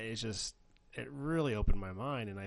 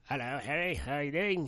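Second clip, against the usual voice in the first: mock Hagrid voice; 6 semitones higher, 4 dB louder than usual. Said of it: "doing my Harry Potter".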